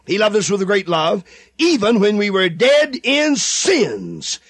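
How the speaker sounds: usual speaking voice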